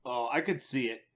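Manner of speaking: ordinary talk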